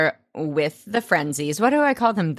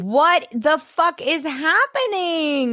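stunned disbelief; 8 semitones higher, slower than usual. Retold or imitated. Stunned reaction to the episode.

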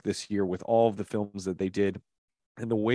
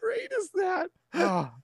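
much higher, squealing with laughter; laughing shout. Real, not acted. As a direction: crack up as you say it. Laughing at the punchline.

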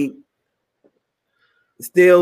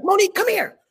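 high-pitched imperious call; bossy summons; 12 semitones higher than usual. Retold or imitated. Producer calling the auditioner over, bossy. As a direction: play the boss ordering someone over.

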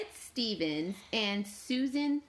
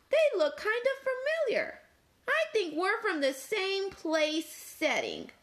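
chatty Fork; 6 semitones higher than usual. Acted. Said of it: "Fork thought for a moment".

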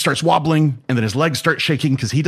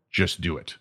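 stern master; 6 semitones lower, 7 dB quieter than usual. Acted. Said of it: "And he goes".

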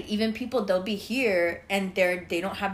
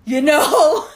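laughing quote; 5 semitones higher, 11 dB louder than usual. Retold or imitated. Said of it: "I was just like".

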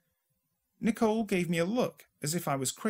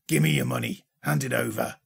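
much lower, louder threatening thug; menacing mugger; 5 dB louder than usual. A narrator's reading. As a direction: growl the demand low. Mugger with knife demands money.

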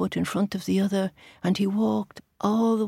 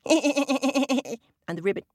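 giggly frog cackle; frog laugh; 7 semitones higher, much slower than usual. Shown as a demonstration. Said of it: "not very good at laughing and ribbiting".

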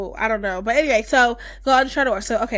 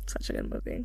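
whispered on the breath, dreamy; breathy whisper; 13 dB quieter than usual. Real, not acted. Dreamy repeat after praising the film.

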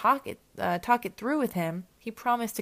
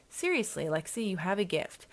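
usual speaking voice

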